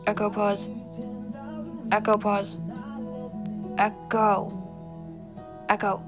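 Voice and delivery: repeated loud call to the device over music; loud command. Real, not acted.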